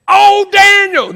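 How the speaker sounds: loud, high, drawn-out cry; yelling king. Acted. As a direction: call out to Daniel at full voice. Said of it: "he starts yelling".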